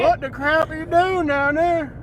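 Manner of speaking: high drawling holler; exasperated holler. Real, not acted. Driver at someone on the road, exasperated holler. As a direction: holler it in disbelief.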